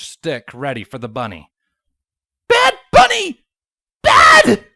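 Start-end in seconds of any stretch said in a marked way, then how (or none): 2.5-4.7 shrill screeching yell. A narrator's reading. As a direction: screech it at the bunny. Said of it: "The farmer screeched".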